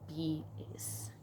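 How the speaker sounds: low whispered aside. Real, not acted. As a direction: drop it to a whisper. Diary complaint about class.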